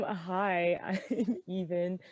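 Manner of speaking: ordinary talk, nothing marked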